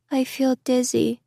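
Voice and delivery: a hint of a small weak child; soft dizzy child. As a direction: say it faintly like a woozy kid. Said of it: "someone said softly".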